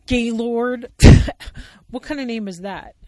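hammed-up sarcastic puppet bear. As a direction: scoff at the name. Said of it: "interrupts sarcastically".

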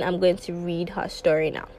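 ordinary talk

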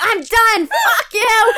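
high, loud angry shout. Retold or imitated. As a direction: yell it like you're quitting. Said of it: "I was like".

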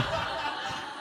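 quick unvoiced chuckle; a short breathy laugh; soft breathy laugh. Real, not acted. Laughs at own hospital story.

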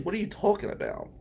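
high, quick brush-off; retold clueless comeback; mock bafflement. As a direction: imitate her tossing off a quick, high denial. Retold breezy denial of beating him up.

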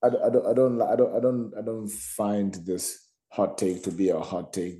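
ordinary talk, nothing marked

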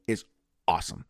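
ordinary delivery

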